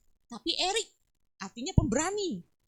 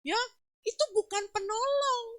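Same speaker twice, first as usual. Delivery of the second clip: usual speaking voice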